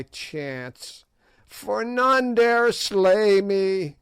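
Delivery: high sneering witch; old witch voice. A narrator's reading. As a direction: play the ancient hag taunting the king. Gagool boasts her great age.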